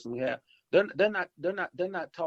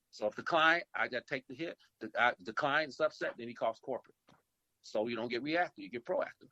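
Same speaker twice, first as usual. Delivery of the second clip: usual speaking voice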